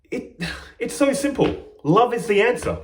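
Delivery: real tearful catch in the voice; choked up. Real, not acted. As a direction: overcome with feeling, nearly crying. Moved while speaking of love.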